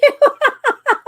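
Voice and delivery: loud squealing laugh; high burst of laughter. Real, not acted. Laughs at viewer joke.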